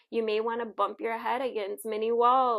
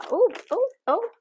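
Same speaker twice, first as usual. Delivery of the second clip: quick, high, louder little ohs; surprised; 12 semitones higher, 8 dB louder than usual. Real, not acted. Card reader reacting as cards jump out, surprised. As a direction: let out startled, high-pitched ohs. Cards pop out while shuffling.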